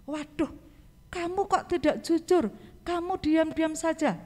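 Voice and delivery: mock scolding. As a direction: act out a mild telling-off. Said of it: "mungkin Tuhan Yesus akan marah". (teguran pura-pura, nada tinggi)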